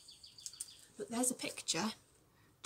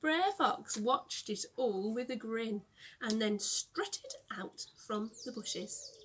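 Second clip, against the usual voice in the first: ordinary delivery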